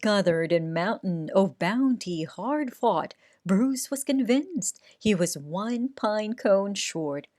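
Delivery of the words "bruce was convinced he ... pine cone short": plain reading voice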